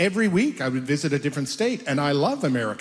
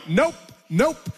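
higher, quick nope nope; dismissive brush-off; 7 semitones higher than usual. Retold or imitated. Passersby brushing off the reporter, quick and higher. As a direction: wave them off. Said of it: "And they would go".

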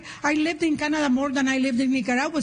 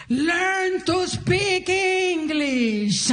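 slow, higher mock yell; retold yell; 5 semitones higher, much slower than usual. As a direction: drag it out like a sneering heckler. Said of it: "the guy yells at me".

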